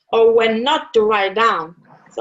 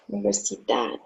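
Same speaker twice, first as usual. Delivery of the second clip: Universidad repeated much softer and lower; 4 semitones lower, 9 dB quieter, faster than usual. Shown as a demonstration. Teacher quietly repeats Universidad for the class. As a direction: murmur Universidad once more. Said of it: "So we have".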